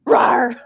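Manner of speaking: short, self-conscious little rawr; embarrassed roar attempt. Shown as a demonstration. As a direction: attempt a roar, about to crack up. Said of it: "let's see if I can do it again".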